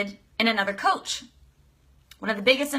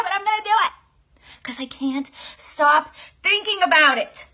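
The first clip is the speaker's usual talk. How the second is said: higher, rising fast resolve; determined excitement; 7 semitones higher, faster than usual. Acted. Client voiced deciding to quit her job, high determined rush. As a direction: build into bold commitment. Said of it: "You're like".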